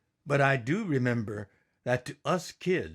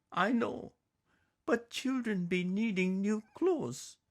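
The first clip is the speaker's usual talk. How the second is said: much higher, firm mother's voice with broken English; Mama's voice; 10 semitones higher, 3 dB quieter than usual. Acted. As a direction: insist gently like a determined mother. Mama answers husband.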